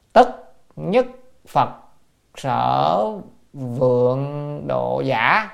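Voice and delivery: word by word, with pauses; scripture recitation. Real, not acted. Teacher reads the quotation before explaining it, word-by-word recitation. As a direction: recite slowly, separating each word. Reads classical words one by one.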